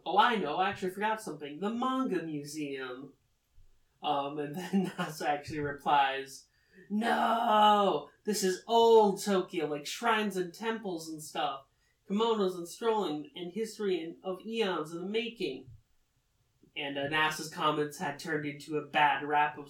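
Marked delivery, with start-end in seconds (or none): 0.1-3.1 play-acted eureka moment; sudden idea. 6.9-15.7 hammed-up outburst that eases off; emphatic objection. Acted emphatic objection to suggestion.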